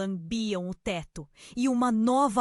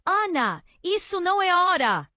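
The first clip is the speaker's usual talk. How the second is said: loud, high shout back; grito urgente; 8 semitones higher, 8 dB louder than usual. A narrator's reading. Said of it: "ele gritou de volta". (grito de volta, forte e agudo)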